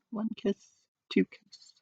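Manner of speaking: hushed, whispered count of kisses; soft counting. A narrator's reading. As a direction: breathe out each kiss quietly. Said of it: "counting the kisses softly".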